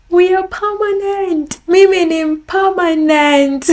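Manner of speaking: high, drawn-out cry of joy; elated. Real, not acted. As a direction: proclaim it with overwhelmed delight. Celebrating getting permanent residency.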